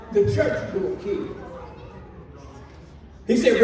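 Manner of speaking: ordinary delivery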